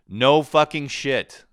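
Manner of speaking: loud, slow, drawn-out sarcasm; sarcastic. Real, not acted. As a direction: hit it with heavy mock disbelief. Sarcastic reply to cliché advice.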